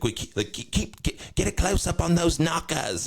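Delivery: high, halting silly voice; put-on band frontman. Band directing the camera operator, high parody voice. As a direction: stutter into a high mock stage call.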